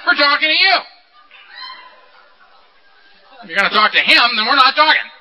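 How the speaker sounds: hammed-up mock yell, demanding attention; yelling beast. The pissed beast scolds him for telling Donald, mock yelling. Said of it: "He's yelling at me".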